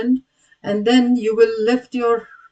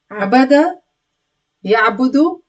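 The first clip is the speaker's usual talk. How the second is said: normal talking voice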